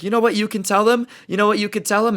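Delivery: high, challenging comeback; heated retort. Retold or imitated.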